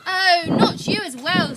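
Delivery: loud exasperated shout. A narrator's reading. Nora shouts at the polar bear, exasperated. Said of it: "shouts Nora".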